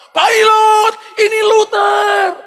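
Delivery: very high, loud, stretched shout; re-enacted boy calling skyward; yelling to the pilot. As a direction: holler up so the pilot hears. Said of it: "Kamu teriak, pasti pilotnya dengar".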